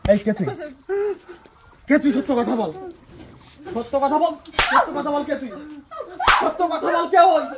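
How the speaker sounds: loud, furious demand for the truth; furious shout. Acted. Husband demands the truth from the stranger, furious shout. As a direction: bark it at him again and again, rising. Son interrogating the young man.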